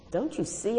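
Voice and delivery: high plaintive wail. Acted. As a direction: wail it like a hurt kid. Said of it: "I wailed".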